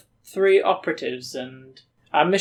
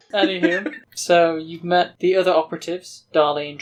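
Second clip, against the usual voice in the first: ordinary delivery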